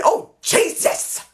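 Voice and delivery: breathless high gasped "Oh, Jesus!"; airy, high-pitched "Oh, Jesus!" of amazement; imitating the commentator's gasp. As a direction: gasp it out in shocked delight. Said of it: "you hear him say".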